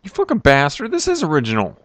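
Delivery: furious shout at the level maker; angry outburst. Real, not acted. Yelling at Dark's level, real anger. Level surprises him, he erupts.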